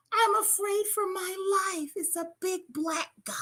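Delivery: high, hushed fake-frightened caller voice; high whispery mock-afraid 911 call; mock scared caller. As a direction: put on a timid victim voice for the police.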